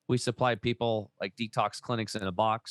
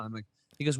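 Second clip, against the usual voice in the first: ordinary delivery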